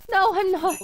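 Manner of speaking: loud, high protest; indignant. Acted. Widow arguing with dead husband's voice, indignant. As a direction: fire back at the accuser. Denying accusation, in quarrel.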